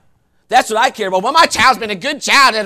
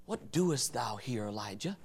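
hushed question; 21 dB quieter than usual. Acted. God quietly asking Elijah why he is there, hushed. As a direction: ask it gently from close by.

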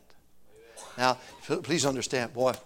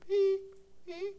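beeping alarm; 14 semitones higher, 6 dB quieter than usual. Retold or imitated. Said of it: "I hear beep".

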